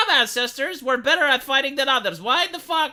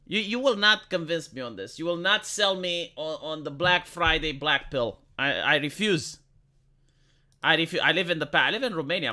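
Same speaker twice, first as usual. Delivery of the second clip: ordinary talk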